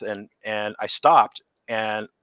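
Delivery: normal talking voice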